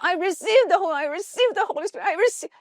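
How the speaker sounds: high, loud repeated cry of receiving; loud fervent shouting; shouting in worship. Retold or imitated. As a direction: call it out again and again, fervent. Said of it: "Tapos sigaw ako ng sigaw".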